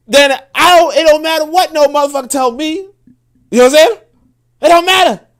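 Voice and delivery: heated rant. Real, not acted. Heated rant about building alone.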